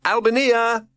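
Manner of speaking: put-on boy calling up to his mother. A narrator's reading. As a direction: call up to someone high above. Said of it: "he called up the tree".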